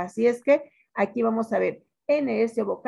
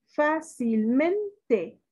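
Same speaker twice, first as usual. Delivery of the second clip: "Fácilmente" very slow, fa, cil, MEN, te, higher pitched; stress on MEN; 4 semitones higher, much slower than usual. Shown as a demonstration. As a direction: sound out "Fácilmente", lifting MEN. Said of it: "Su pronunciación de sílaba tónica".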